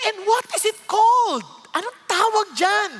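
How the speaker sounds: high, loud curious asking; woman on the plane asking excitedly; eager question. Retold or imitated. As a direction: lean in, dying to know. Woman eagerly asks what it is.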